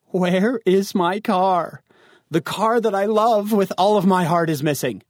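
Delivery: high, drawn-out wail of a question; dramatic dismay. Acted. Teen finds the beloved new car gone, theatrical dismay. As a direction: play up the heartbreak over the missing car.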